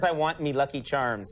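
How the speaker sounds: slow mock Irish brogue; mock Irish accent. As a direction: drawl it like a cereal-ad leprechaun. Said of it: "He says".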